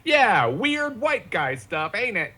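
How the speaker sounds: very high, drawn-out goofy voice; hammed-up self-mockery. Comedian deflects praise for his Mandarin, hammed-up goofy. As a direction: brush off the compliment in a silly high voice.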